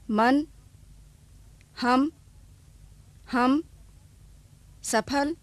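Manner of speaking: each word said twice with a clear short अ; slow, careful dictation of अ words. Shown as a demonstration. Teacher reading अ words aloud for the class. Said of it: "इनमें अ ध्वनि है".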